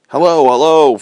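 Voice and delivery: loud storybook hail; calling out. A narrator's reading. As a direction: hail the people on shore. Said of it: "Fritz called out as we neared the shore".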